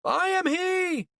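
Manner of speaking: very high, slow, drawn-out shout; out-shout your rival savior; shouted proclamation. A narrator's reading. False Christ shouting at the other Christ, messianic shout. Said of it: "Cantwell shouted at the other Christ".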